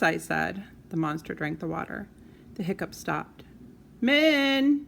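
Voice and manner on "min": high, loud call across the house; mom calling. Acted. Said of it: "yelled Mom".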